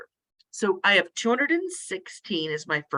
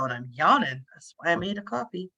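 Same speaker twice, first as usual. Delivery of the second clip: normal talking voice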